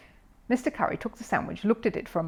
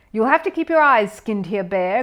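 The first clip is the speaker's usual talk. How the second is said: gruff, louder warning growl; 3 semitones higher, 7 dB louder than usual. A narrator's reading. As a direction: growl it out like a cross golfer. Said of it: "growled Mister Curry".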